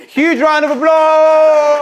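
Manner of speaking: high, loud, stretched-out cheer; cheering shout. Real, not acted. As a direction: whip the room into applause. Cheering a student's speech.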